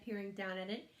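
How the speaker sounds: ordinary delivery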